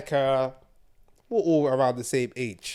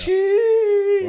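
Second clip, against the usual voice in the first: 1 shocked; 3 dB louder than usual. Real, not acted. Shock at the wrestler's death.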